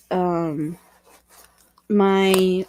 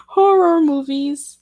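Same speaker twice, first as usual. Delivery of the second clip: laughing; 6 semitones higher, 6 dB louder, slower than usual. Real, not acted. Laughing while naming horror films.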